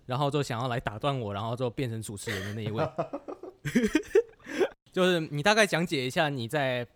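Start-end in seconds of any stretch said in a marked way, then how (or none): 2.9-4.7 laughing. Real, not acted. Introducing the interrupting cohost.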